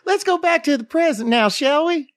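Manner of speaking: mock [name]. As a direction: float it up into a breathy falsetto. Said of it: "Sounds like you're doing [name]".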